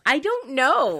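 loud, high exclamation through laughter; laughing protest. Real, not acted. Teased, protests while laughing.

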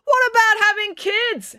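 high, drawn-out heckle; heckling. A narrator's reading. April heckles Dad during his speech, indignant. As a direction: call out indignantly from the crowd. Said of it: "heckled April".

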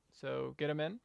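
ordinary delivery, nothing marked

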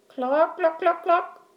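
high squawky clucks; hen clucking. A narrator's reading. Hen voiced before laying.

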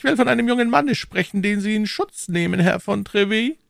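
high, cool and unhurried cardinal; phlegmatic cardinal. A narrator's reading. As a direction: say it with utter composure. Cardinal voiced.

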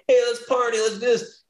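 high, eager party invite; party friends calling. Retold or imitated. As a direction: call him out like a hyped friend.

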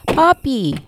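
calling out to grandpa across the street; a girl calling her grandfather as she gets out. A narrator's reading. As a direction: call out loud to her grandfather. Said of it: "calls out towards her grandfather".

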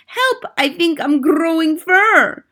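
put-on high, panicked boy voice; mock scream. A narrator's reading. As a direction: witch mimicking a terrified child.

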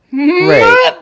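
very high, loud forced "great"; mock-cheerful squeak. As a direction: squeal it in fake enthusiasm. Sarcastic reply to killing news.

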